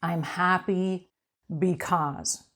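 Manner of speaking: slow, drawn-out "I'm happy because"; slow emphasis. Shown as a demonstration. Coach teaching owners the three words, slow and deliberate. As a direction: lean into each word slowly. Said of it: "remember these three words".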